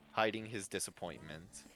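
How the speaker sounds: ordinary delivery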